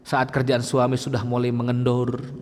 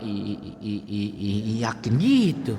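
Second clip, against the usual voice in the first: mock hesitant nodding; 5 semitones lower than usual. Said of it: "Ngangguknya pelan-pelan". (tiruan ragu-ragu yang pelan)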